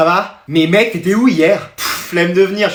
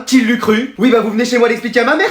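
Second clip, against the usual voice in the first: usual speaking voice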